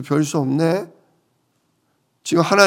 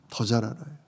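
soft echo; 6 semitones lower, 12 dB quieter, faster than usual. Shown as a demonstration. Preacher echoing to the congregation, much softer. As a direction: repeat 더 잘 알아요 much softer, almost to yourself. Repeats line, second time softly.

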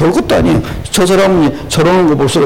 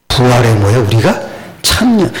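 ordinary delivery

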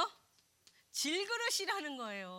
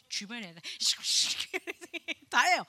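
laughing; 7 dB louder than usual. Real, not acted. Laughing at own gossip.

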